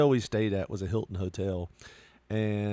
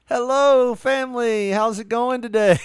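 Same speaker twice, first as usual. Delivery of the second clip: much higher and louder cheery sales greeting; loud chirpy manager; 11 semitones higher, 8 dB louder than usual. Retold or imitated. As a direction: burst in like an overbright hotel manager. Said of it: "all of a sudden I hear".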